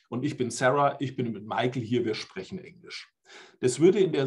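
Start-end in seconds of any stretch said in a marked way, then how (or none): none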